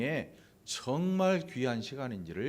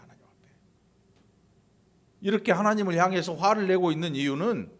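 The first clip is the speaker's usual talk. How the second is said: ordinary delivery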